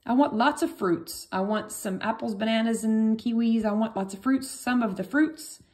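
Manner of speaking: pretend student. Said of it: "maybe you wanna say".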